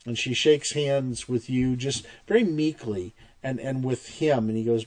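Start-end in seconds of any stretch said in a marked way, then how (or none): none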